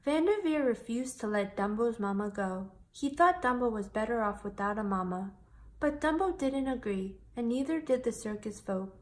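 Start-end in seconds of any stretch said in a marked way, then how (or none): none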